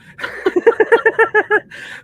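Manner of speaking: high wheezing laugh. Real, not acted. Laughing at his own coffee rant, high laugh. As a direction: burst into a squealing laugh.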